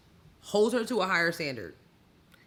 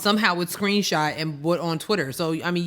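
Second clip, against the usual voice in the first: ordinary delivery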